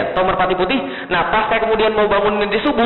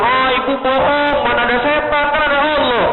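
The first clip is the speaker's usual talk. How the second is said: put-on child's reply; 6 semitones higher than usual. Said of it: "Anaknya bilang".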